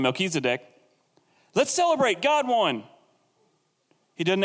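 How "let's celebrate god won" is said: high-pitched, acted burst of joy; jubilant celebration. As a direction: cheer the victory in a much higher voice. Voices Melchizedek rejoicing at victory.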